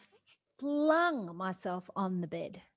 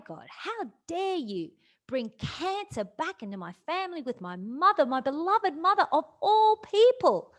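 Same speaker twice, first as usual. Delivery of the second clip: much higher and louder, a furious cry that keeps climbing; yelling at God; 8 semitones higher, 9 dB louder than usual. Retold or imitated. Grieving daughter yells at God not to take her mother, furious and loud. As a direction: rage at God, refuse to let him take her mother. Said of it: "So I yelled at God".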